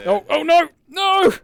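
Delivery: high, loud panicked cry; alarmed shout. Real, not acted. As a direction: yell out in sudden alarm. Sudden danger in game.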